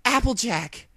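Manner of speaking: high, loud cry of her name; screamed name. A narrator's reading. Dash screaming for Applejack, high and loud. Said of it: "Dash screamed".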